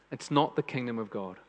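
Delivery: ordinary talk, nothing marked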